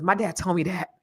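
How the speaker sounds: ordinary talk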